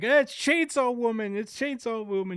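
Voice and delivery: high, playful teasing voice. Retold or imitated. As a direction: tease her in a high, sing-song voice. Yuko joking, high voice.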